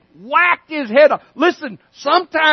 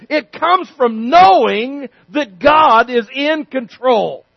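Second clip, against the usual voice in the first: slow emphatic; slower than usual. Real, not acted. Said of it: "slow emphatic repeat".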